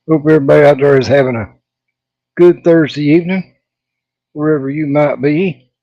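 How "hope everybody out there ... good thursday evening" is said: ordinary delivery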